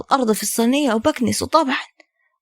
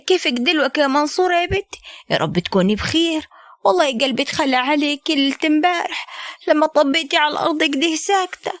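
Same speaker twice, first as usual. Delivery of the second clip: a mother-in-law in a raised, put-on voice; 6 semitones higher than usual. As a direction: play the fussing mother-in-law a little higher. (حماة بصوت أعلى مصطنع)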